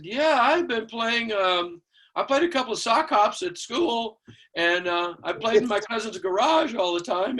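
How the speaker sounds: put-on high, slow, eager-to-impress boy; green kid. Kid telling older musicians about his playing, naive and hesitant. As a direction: imitate a youngster padding his experience.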